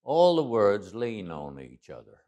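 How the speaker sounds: put-on slow, drawled-out Texas accent. Accent teacher explaining how the words lean, in a slow Texas accent. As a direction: let the words lean together in slow Texan. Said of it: "the Texas ac-, accent".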